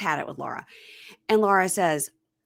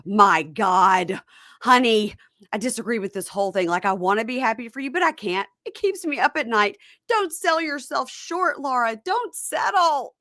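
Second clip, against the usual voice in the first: higher-pitched imitated motherly plea; mom's overwrought pleading; 5 semitones higher than usual. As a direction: plead dramatically as a disapproving mom. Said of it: "She is just throwing bombs".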